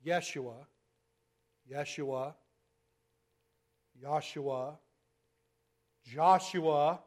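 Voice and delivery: morph Yeshua into Joshua, one take at a time; name shifting. Shown as a demonstration. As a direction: show how Yeshua turns into Joshua. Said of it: "Now watch".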